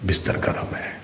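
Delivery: hushed, very soft temptation; tempting inner voice. Acted. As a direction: lull the sleeper almost under the breath. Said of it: "اگلی آواز پھر آئی".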